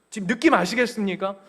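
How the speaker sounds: ordinary talk, nothing marked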